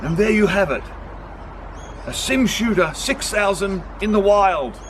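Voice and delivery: lightly done wildlife presenter; grand documentary voice, lightly; nature documentary narrator. Pretend.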